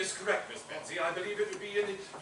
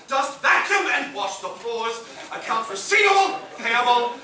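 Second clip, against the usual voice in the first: exasperated listing; 3 semitones higher, 7 dB louder than usual. Acted. Butler's exasperated duty list.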